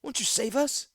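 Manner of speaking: high, small pleading question; desperate plea. Acted. Young man begs Gix to save them.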